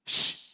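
put-on sharp, short "shh" to unruly kids; parent's shush. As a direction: hush noisy children firmly. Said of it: "when your kids are acting up".

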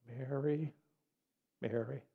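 soft, tender calling of a name; compassionate. Acted. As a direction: say the name softly, full of compassion. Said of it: "compassion of Jesus".

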